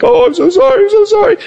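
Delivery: high, pleading child voice, mock sorry; a kid overdoing the apology; whiny kid caught out. Kid caught by parents, whining sorry in a high voice. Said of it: "They're just like".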